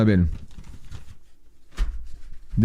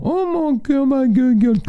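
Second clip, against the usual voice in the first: much higher, put-on squeaky falsetto coo; squeaky girlfriend voice; 13 semitones higher than usual. Man mocks girlfriend cooing at the dog, squeaky falsetto. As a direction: squeal it like an over-the-top dog lover. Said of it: "Non, moi, je l'entends".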